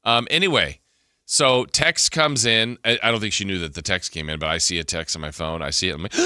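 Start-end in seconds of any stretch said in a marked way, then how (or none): none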